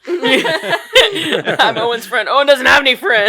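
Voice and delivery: high, loud hammed-up movie voices; acted film exchange. Friend introduces himself to Owen's mother, hammed-up shouting. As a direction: play out the scene at full volume, amused.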